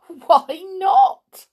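high, loud chuckle into words; chuckle, then ask brightly; chuckling. A narrator's reading. Visitor questions the outraged king, chuckling. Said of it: "she chuckled".

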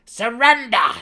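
high, loud command shout; demanding. A narrator's reading. Brisa demands her brother surrender, shouting. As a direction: bark the single word. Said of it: "she demanded".

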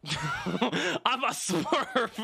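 slow laughing punchline. Real, not acted. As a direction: giggle out the joke slowly.

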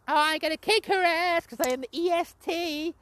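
high, mocking put-on voice; mocking Bianca Belair. Mocking Bianca Belair's commentary boast in a high put-on voice. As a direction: mimic her boastful brag. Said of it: "Bianca Belair on commentary was like".

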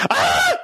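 very high short mock scream; brief high-pitched retold scream; imitated scream. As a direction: mimic a shocked lady's shriek. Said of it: "She screamed".

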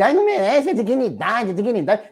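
lightly mocking, whiny tone; a hint of a mocking whine; mock whiny quote. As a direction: parody the complainers with a sneer. Mocking opponents' dignity talk.

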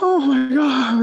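long, loud falsetto swoon; mock overwhelmed woman. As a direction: gush in a high imitation of a thrilled woman. Acts woman thrilled by proposal.

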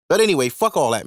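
ordinary talk

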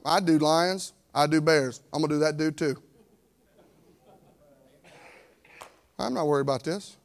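low, quiet and unbothered, shrugging off the giant; lightly voiced cool young David; calm confident David. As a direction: say it like it's nothing, softly.

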